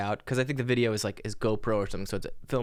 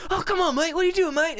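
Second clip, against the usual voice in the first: high, loud Australian accent, exaggerated; mock Australian yell, very high; Australian instructor; 7 dB louder than usual. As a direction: bellow it like a panicked Australian skydiving instructor. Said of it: "the Australian-ness of it".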